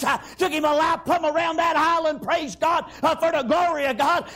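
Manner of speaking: fervent shouted sermon delivery; preaching at full force. Acted. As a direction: preach it hard, phrase after phrase.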